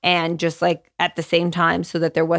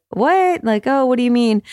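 higher-pitched, puzzled quote of a partner; imitated puzzled surprise; 6 semitones higher, 4 dB louder than usual. Partner answering the pediatrician's praise, puzzled surprise. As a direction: act out a taken-aback, questioning reply.

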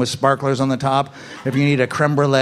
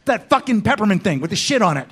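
higher-pitched mock coffee order, leaning on the swearing; sweary order; 7 semitones higher than usual. Comic showing a customer how to order, sweary. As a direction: order it like you can't be bothered with the name. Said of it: "say it with a swear word in it".

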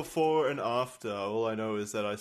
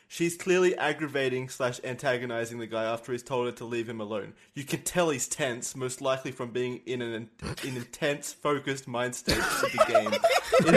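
reading a comment aloud, cracking up; laughing while reading; 5 dB louder than usual. Retold or imitated. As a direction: try to read it straight but break into laughter. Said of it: "I'll read it verbatim".

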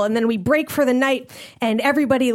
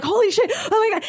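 panicked; 9 semitones higher, faster than usual. Retold or imitated. Panicked escape.

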